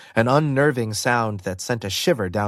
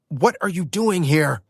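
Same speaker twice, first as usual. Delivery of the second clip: Matt's startled yell, a bit higher; 5 semitones higher than usual. Acted. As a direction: shout the question in surprise. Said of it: "Matt yelled".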